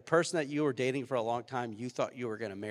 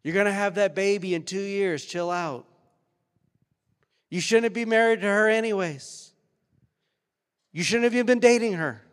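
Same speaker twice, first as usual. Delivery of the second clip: higher, louder heavenly voice; God from the clouds; 7 semitones higher, 9 dB louder than usual. Pretend. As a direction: proclaim it like God booming down. Imagined God speaking from the clouds.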